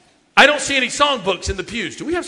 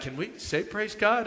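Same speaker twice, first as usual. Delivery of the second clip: hushed leader; 12 dB quieter than usual. Retold or imitated. Campfire leader quote.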